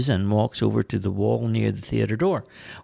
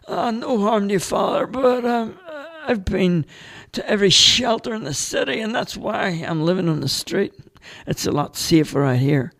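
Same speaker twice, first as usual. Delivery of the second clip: much higher, louder character voice; Dale's voice; 12 semitones higher, 4 dB louder than usual. Acted. Homeless Dale turning down the priest, high character voice. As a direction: play the street artist, eager and chatty. Dale explains living on street.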